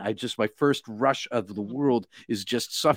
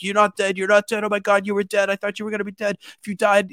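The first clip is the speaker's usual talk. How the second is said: high-pitched, rushed frantic cries, retold; imitated crying panic; 10 semitones higher than usual. Climbing partner frantic over the narrator waking, imitated crying panic. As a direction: act out a companion crying out in disbelief. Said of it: "he's crying, and he's screaming".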